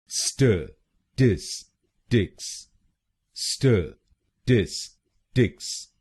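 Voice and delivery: Statistics drawn out slowly as stə-tis-tiks; slow syllable by syllable. Shown as a demonstration. Teacher slows the word down for learners. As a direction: stretch statistics out, each syllable separate. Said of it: "तो बनेगा statistics".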